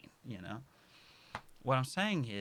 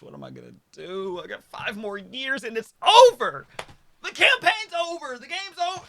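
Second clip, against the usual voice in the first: much higher, louder mock despair; hammed-up panicked wail; 13 semitones higher, 14 dB louder than usual. Retelling his birthday breakdown, hammed-up panicked wail. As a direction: wail it like the world is ending. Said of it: "I was crying. I was like".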